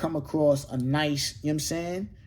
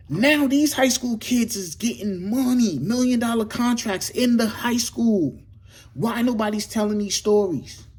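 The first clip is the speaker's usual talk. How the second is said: impassioned rant; 7 semitones higher, 4 dB louder than usual. Real, not acted. Host ranting to Hollywood about untold stories, impassioned.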